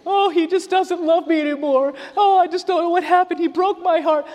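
high, whiny lovesick moan; mock heartbroken woman.

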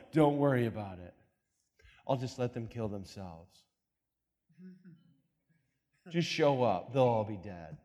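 lightly voiced calm God; calm, low divine reassurance. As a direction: soothe them, unbothered. Voicing God calmly.

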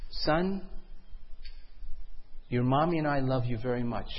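soft, gentle reassurance; tender parent. Acted. Parent tells son they love him, tender and soft. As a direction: speak lovingly and quietly.

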